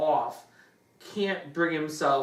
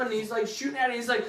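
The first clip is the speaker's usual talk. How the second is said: ordinary talk, nothing marked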